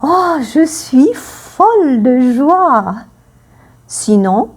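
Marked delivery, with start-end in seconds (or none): 0.0-3.0 high, slow, overjoyed exclamation; mock overjoyed "Oh, je suis folle de joie"; acted joy. Shown as a demonstration. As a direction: gush with delight like a thrilled woman. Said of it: "elle dira".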